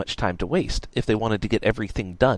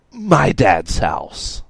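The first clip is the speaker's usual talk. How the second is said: slow, forced-out admission; reluctant admission; 6 dB louder, much slower than usual. A narrator's reading. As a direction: drag it out loudly, as if hating to say it. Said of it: "not wanting to say it".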